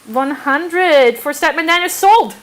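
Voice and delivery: slow announcing of the sale; auctioneer call. Real, not acted. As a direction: declare it sold, drawn out. Closing the auction.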